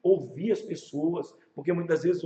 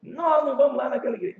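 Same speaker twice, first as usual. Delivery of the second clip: higher-pitched mock protest; retold indignant refusal; 6 semitones higher than usual. Offended member swearing off that church, in mock indignation.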